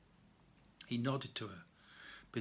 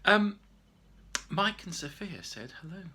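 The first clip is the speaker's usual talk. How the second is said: ordinary delivery, nothing marked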